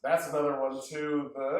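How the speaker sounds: usual speaking voice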